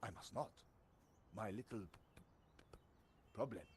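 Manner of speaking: hammed-up French sergeant accent; a tempted French soldier; comic French accent, torn. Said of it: "you just get a French accent".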